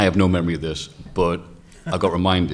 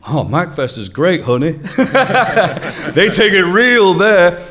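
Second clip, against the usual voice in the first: much higher and louder, slow and laughing; wife's gushing voice; 11 semitones higher, 9 dB louder, slower than usual. Retold or imitated. Wife telling her husband at home, laughing and gushing. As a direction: gush it like a delighted wife.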